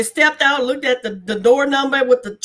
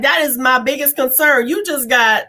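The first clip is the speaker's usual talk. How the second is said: ordinary talk, nothing marked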